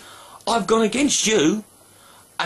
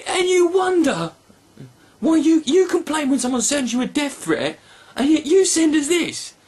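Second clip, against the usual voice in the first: ordinary talk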